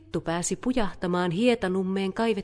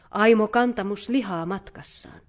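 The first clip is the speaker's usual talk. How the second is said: usual speaking voice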